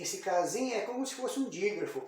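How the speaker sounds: ordinary talk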